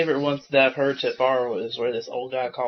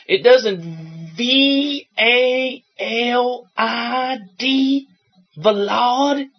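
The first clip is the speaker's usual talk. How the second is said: confused caller; 7 semitones higher, 4 dB louder, much slower than usual. Retold or imitated. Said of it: "then she's like".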